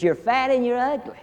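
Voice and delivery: high parrot voice, cheeky; parrot insult. Acted. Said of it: "He says".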